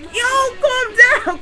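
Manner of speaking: high-pitched mock cry to settle down; retold urgent plea. Teller to friend panicking behind him, high exasperated mock plea. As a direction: plead over the top with a frantic friend.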